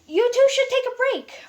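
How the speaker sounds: motherly Pearl. Acted. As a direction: fuss over them like a mother. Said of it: "being her motherly self".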